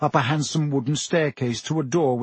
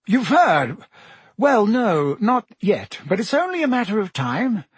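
uneasy Minister voice; 7 semitones higher than usual. A narrator's reading. Fudge voiced by narrator.

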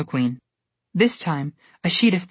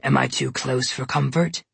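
low, sly bear voice; mocking bear; 4 semitones lower than usual. A narrator's reading. Polar bear taunting Goldilocks, low and sly. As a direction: toy with the prey. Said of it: "the polar bear asked her".

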